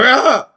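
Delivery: high, acted gasp of panic on waking; startled waking cry. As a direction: jolt awake with a yelp. Acts out waking up late.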